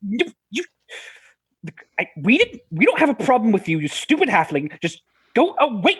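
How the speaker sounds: high, sputtering mild exasperation; stammer, then shoo them off in frustration; a little frustrated. Acted. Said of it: "getting a little frustrated".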